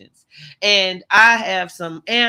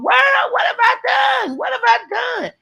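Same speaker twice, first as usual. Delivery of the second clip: hammed-up shock; mock outrage. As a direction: throw up a shrill-free high shout of what have I done. Mock outrage at being attacked.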